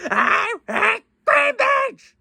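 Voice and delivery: very high, slow squawky parrot echo; high slow parrot squawk. Pretend. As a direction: squeal it out like a parrot. Parrot repeats higher.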